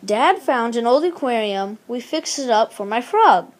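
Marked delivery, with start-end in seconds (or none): none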